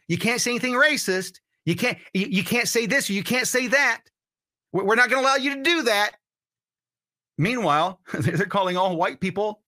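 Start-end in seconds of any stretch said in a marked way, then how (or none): 0.1-6.2 higher-pitched mock scolding censor; mock speech police. Host imitating speech police banning what people can say, mocking. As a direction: lightly play a censor laying down prohibitions, pitched up.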